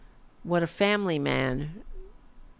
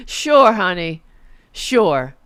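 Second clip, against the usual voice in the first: sarcastic sure; 9 dB louder, slower than usual. Real, not acted. Mocking Melania's claim.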